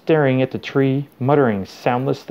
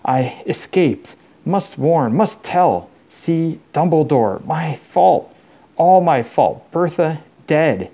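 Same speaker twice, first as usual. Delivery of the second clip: halting, slow, higher strained voice; desperate confession; 5 semitones higher, slower than usual. A narrator's reading. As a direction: force out broken phrases in anguish. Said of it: "he whispered, his eyes bulging again".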